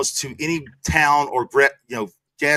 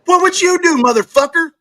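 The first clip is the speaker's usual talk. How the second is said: high, loud demand; shouting back at the host; heated challenge; 13 semitones higher, 5 dB louder than usual. Real, not acted. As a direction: throw the question back hard. Defending himself in heated argument.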